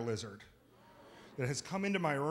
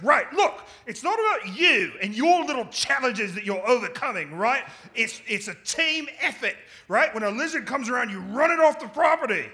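high, loud lecturing Aussie bloke; bossy Aussie mate; 7 semitones higher, 7 dB louder than usual. Retold or imitated. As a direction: lay down the law like a know-it-all mate. Roommate lecturing about lizard teamwork.

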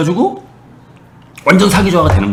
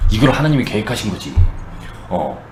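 ordinary talk

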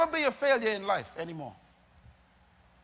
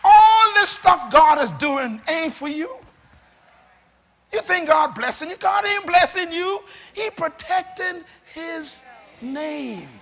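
high, loud, drawn-out pulpit delivery; full-force preaching; 6 semitones higher, 8 dB louder than usual. Real, not acted. Preacher telling listeners God protects his name, high and emphatic. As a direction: drive each phrase home, raised and forceful.

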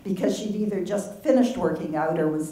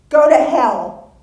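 high, loud, slow angry outburst; grieving mother lashing out at her sister; furious cry; 7 semitones higher, 11 dB louder, slower than usual. Acted. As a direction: hurl it at her in rage. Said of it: "Marcy cried".

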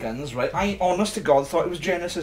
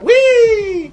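a much higher, louder whoop of delight; gleeful whee; 7 dB louder than usual. Acted. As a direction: squeal it like a rollercoaster drop. Thrilled by hitting checkpoints.

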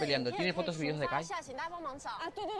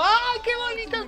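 delighted squeal; 8 dB louder than usual. Real, not acted. Gushing at declaration.

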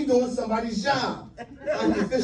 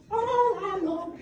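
high, breathy-singer put-on; mock singer voice; 11 semitones higher, 5 dB quieter, much slower than usual. Mock diva squeal at the audience. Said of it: "You was all like".

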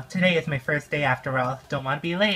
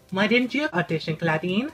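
ordinary talk

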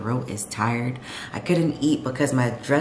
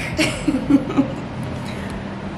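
high unvoiced giggle; breathy high giggle after a quip; high laugh; 12 semitones higher than usual. Real, not acted. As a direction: giggle at your own joke. Laughing at her joke.

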